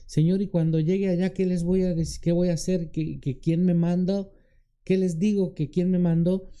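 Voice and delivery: high, fretful string of questions; reluctant, pleading protest; Moses making excuses. Acted. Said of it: "aquel que pone pretextos como Moisés".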